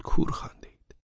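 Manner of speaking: hushed whispered aside. A narrator's reading. Narrator teasing the audience, whispered. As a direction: tell the listeners in a whisper.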